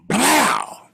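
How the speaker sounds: high, explosive "blow"; imitated crash. Thrown thing hits the alley, imitated crash. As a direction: burst out the crash noise.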